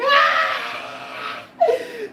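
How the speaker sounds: high, loud howl of laughter near tears; shrieking laugh. Real, not acted. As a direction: laugh till it almost turns to crying. Teller breaks up laughing.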